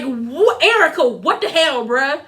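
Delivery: ordinary talk, nothing marked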